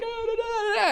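very high wailing gibberish; mock tearful babble. Actor re-enacts getting into the crying scene, mock wailing. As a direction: babble the lines through an exaggerated cry. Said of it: "I started to cry and started to get into it".

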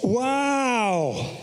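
long, high drawn-out wow; astonished wow. Real, not acted. Preacher reacting to people who were whipped, astonished. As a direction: let amazement stretch the word out.

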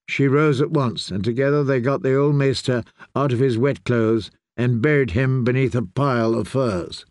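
usual speaking voice

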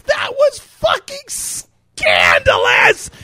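slow, high, laughing outcry; laughing shout. Retold or imitated. Dad laughing out what he told his daughter. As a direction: wail it through laughter. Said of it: "all I could say was".

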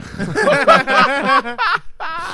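big high burst of laughter; high loud laugh. Real, not acted. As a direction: crack up at the name-drop. Laughing at mom's name-drop.

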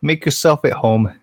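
put-on a hammy Canadian accent. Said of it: "the worst Canadian accent I've ever heard".

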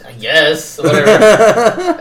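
drawn-out grudging agreement, swelling loud and high; mock reluctance. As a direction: give in with exaggerated reluctance. Retelling his reluctant agreement.

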